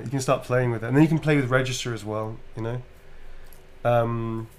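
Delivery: ordinary talk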